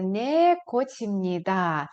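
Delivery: Не кочімніда slow with a falling end; slow model answer. Shown as a demonstration. Teacher drills the answer slowly for students. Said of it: "ви скажете".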